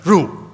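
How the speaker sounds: "Ru" said high and loud as a demo; unstressed Ru. Shown as a demonstration. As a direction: call out "Ru" to show the syllable. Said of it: "You don't emphasize".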